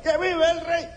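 very high joyful cheer; happiest cheer. Retold or imitated. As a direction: cheer like the happiest man in the parade. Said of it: "el más feliz del desfile".

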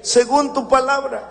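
high, slow and humble; slow reverent quote. Retold or imitated. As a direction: let Mary's words land gently. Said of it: "Oigan bien".

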